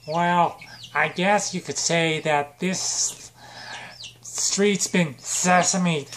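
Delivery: drawn-out, very slow punchline; milk the pun, dragging the last words; slow pun delivery. Acted. Sith Big Bird delivers pun.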